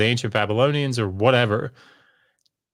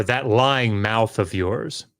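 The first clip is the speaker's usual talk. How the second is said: slow, drawn-out imitation; mock misogynist quote; much slower than usual. Clubhouse misogynist to a woman, slow drawn-out mimicry. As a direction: drag each word out mockingly. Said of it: "he would say".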